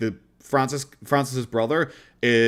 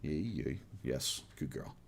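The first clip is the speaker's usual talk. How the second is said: much lower, much softer murmur; soothing the dog; 7 semitones lower, 17 dB quieter, slower than usual. Real, not acted. Streamer calms her husky with a low murmur.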